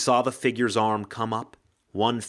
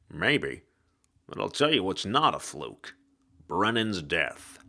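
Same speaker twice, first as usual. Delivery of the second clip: hard-boiled ghost; 3 semitones lower than usual. Acted. Ghost detective voice.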